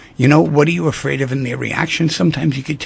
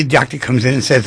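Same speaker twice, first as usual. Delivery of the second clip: ordinary delivery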